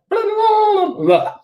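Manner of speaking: squeaky mock sign chant; sing-song blah. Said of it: "I look like a fool".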